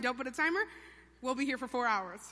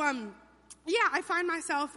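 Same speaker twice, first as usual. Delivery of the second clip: usual speaking voice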